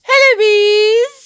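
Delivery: high, bright call of a queen bee; high singsong queen greeting; queen's voice. Acted.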